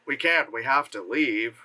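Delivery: low, wooden line reading; mock stiff movie dialogue; one-note acting. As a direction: deliver it lifelessly, pitched low. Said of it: "acting in that movie was like that".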